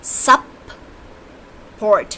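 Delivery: सप-पोर्ट spoken slowly as two parts, सप then पोर्ट; syllable split support. Shown as a demonstration. Teacher showing where the hyphen falls in सप-पोर्ट. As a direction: drag out सप-पोर्ट, pause at the break. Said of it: "Like this".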